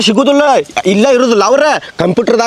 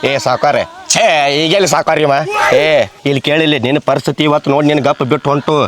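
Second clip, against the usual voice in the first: low, threatening creditor voice; menacing creditor; 8 semitones lower than usual. Acted. (ತಗ್ಗು ದನಿಯಲ್ಲಿ ಬೆದರಿಸುವ ಸಾಲಗಾರ)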